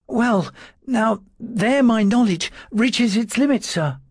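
high, thin timid clerk's voice; a frightened servant trying to sound firm; nervous little secretary. A narrator's reading. Dawes answering his lordship's questions, high and nervous.

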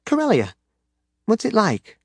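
put-on high, curious little girl. A narrator's reading. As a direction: ask it like an eager small daughter. Child Jaina voiced by narrator.

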